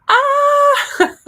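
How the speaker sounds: sudden high shriek of surprise; a sharp high yelp, caught off guard; startled yelp. Real, not acted. Startled on discovering mic unmuted.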